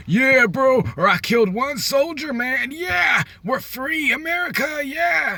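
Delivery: very high, loud, hammed-up celebrating; mock victory cheer. Soldier crowing over one small battle won, mock jubilant shouting. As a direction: whoop it up like a deluded winner.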